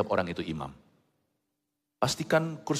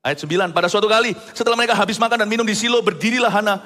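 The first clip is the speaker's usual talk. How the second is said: loud, high, impassioned verse reading; scripture reading; 11 semitones higher, 8 dB louder than usual. Acted. Preacher reads a husband's plea to the congregation, loud scripture reading. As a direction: read the verse out at full force.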